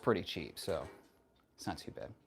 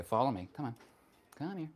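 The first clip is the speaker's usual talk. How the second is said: ordinary talk, nothing marked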